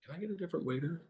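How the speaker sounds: lightly voiced snooty patron asking for another waiter; fussy customer. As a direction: ask it like a picky diner, pinched and hushed. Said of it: "they're gonna be like".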